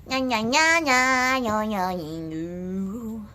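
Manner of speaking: playful nya nya syllables slowing into a drawn-out yo; rolling-tongue warmup. Shown as a demonstration. Teacher demos tongue warmup for class, playful sounds. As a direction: warm up the tongue with silly sounds. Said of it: "roll our R's like a professional".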